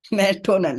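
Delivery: نیٹونل, with "tio" as "to" instead of "sh"; misread "national". Retold or imitated. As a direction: say نیٹونل with a hard t, "nay-TOE-nal". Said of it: "نیشنل کو پڑھتا تھا".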